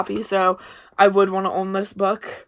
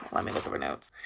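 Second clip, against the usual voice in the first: whispered aside; 13 dB quieter, faster than usual. Real, not acted. Muttered aside checking notes.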